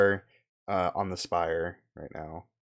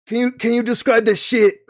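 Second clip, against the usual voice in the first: mock voice; 14 semitones higher, 11 dB louder than usual. Laughing NPC voice demand.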